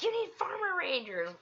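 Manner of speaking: high-pitched excited quote; imitating excited friends. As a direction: pipe up high, imitating an eager suggestion. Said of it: "they were like".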